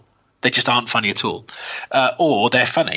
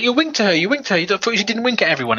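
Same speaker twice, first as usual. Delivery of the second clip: mock accusing friend; 8 semitones higher, faster than usual. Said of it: "He's like".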